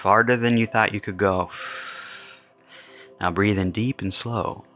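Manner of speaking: slow, deliberate meditation voice with long pauses; guided meditation. Acted. Guiding listeners through clearing resentment, slow guided meditation. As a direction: guide listeners gently, phrase by phrase, leaving space.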